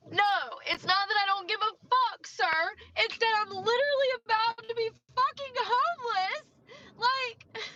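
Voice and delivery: mildly hammed-up indignant comeback; retold outburst. Retelling her outburst at customer.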